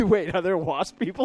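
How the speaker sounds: laughing question. Real, not acted. Laughing at the wasp people idea.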